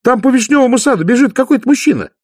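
high, loud, rapid astonished outcry; gasping surprise. A narrator's reading. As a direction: spot a stranger and cry out in excitement. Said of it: "и ахнула".

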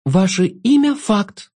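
имя slow and high, falling; insist, stressing имя; emphatic. A narrator's reading. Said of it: "для пущей убедительности".

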